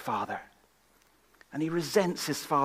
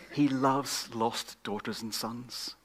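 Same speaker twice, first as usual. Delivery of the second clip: ordinary talk, nothing marked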